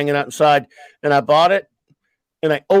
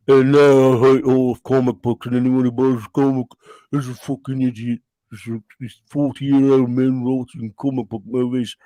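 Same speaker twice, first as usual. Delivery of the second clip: Alan Moore; 2 semitones lower than usual. Retold or imitated. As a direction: drag the rant out like a bitter old author. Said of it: "the Moore talk".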